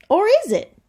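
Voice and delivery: playful dramatic hook; teasing suspense. Acted. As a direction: tease the kids with a twist. Teasing twist while reading.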